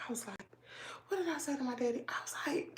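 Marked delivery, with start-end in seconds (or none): none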